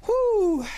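a high, loud, falling whew of disbelief; shocked whew. Real, not acted. Reacting to the ten kids, a shrill loud whew. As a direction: let out a stunned high whew.

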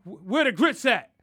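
loud, high demand; grandpa demanding. Retold or imitated. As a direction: call out for the missing grits. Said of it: "He'd be like".

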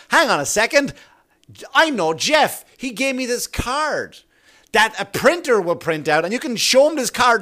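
pleading with the cop. Retold or imitated. Showing the cop his card, eager and pleading. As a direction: play up the innocent guy who knows Jeff.

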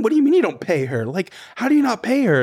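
fast, high incredulous prodding; egging her on. Retold or imitated. Dorinda and Ramona egging Luann on, quick and high. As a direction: needle her with mock disbelief. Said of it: "being like".

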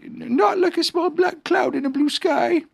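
slow, high, anxious bear; anxious Pooh. A narrator's reading. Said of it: "said Pooh anxiously".

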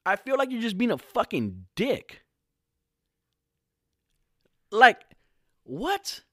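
disbelieving angry outburst, every word hammered; furious yelling. Real, not acted. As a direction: shout it like you can't believe what you just read. Furious rant at Newsom's tweet.

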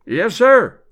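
calling from another room; muffled answer from afar; muffled from the back. Acted. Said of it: "answered a muffled voice".

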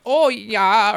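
high, loud mock Midwestern "Oh, yeah"; exaggerated Midwestern accent. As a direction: imitate Americans doing a Midwestern accent. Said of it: "when Americans do".

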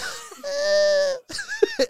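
high shrieking giggle; high squeal laugh. Real, not acted. Laughing at own story.

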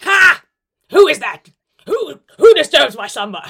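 loud, booming, woken-up Grinch. Acted. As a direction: roar it like a grumpy Grinch roused from sleep. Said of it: "Oh, Grinch".

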